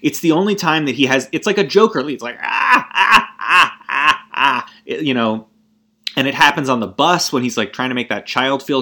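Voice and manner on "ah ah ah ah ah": high hiccuping fake laugh, louder; imitating the Joker's uncontrollable laugh; hiccuping laugh imitation. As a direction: mimic a choked, hiccuping laugh. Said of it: "he laughs this, like, great hiccuping sort of".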